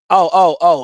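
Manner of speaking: loud, hyped-up oh oh oh; pumped, loud exclamations. Real, not acted. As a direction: fire yourself up. Hyped rant at rival fans.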